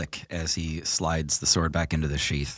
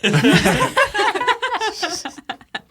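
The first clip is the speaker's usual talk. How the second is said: high loud burst of laughter; cracking up; big laugh; 6 dB louder than usual. Real, not acted. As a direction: laugh hard at yourself. Laughing at own outburst.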